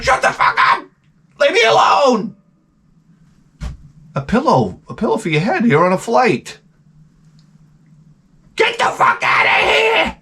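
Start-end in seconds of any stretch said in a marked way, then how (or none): 0.0-2.3 high, strained acted shout; hammed-up high angry outburst; pissed-off yelling. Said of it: "I'd be fucking pissed". 8.6-10.2 very high, drawn-out shout; hammed-up shriek of outrage; pissed-off screaming. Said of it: "I'd be fucking pissed".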